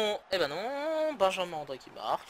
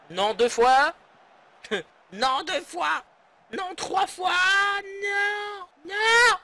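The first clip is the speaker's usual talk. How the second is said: higher and louder protest building; exasperated shouting; 13 semitones higher, 8 dB louder, much slower than usual. Real, not acted. Manager watching his team concede again, shouting. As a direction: shout in disbelief at each goal.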